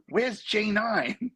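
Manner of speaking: high, very drawn-out question; imitated lost friend. Lost friend asks driver the way, slow mock wail. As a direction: stretch it out slowly, pitched high. He goes.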